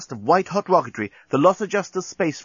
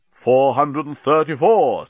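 slow, loud machine countdown; counting bomb; 3 semitones higher, 5 dB louder, slower than usual. A narrator's reading. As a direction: call the number out slowly as a bomb. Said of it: "said the bomb".

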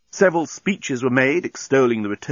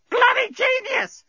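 very high, slow parrot screech; parrot squawk; slower than usual. A narrator's reading. Parrot answering Bolfas, shrieky drawn-out squawk. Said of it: "squawked the parrot".

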